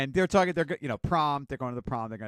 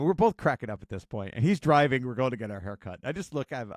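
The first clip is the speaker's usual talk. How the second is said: ordinary delivery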